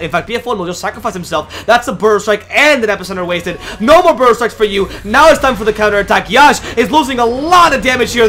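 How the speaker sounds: loud, high shoutcast building in intensity; excited play-by-play. Real, not acted. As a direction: call the counterattack at full tilt. Live play-by-play of a team fight.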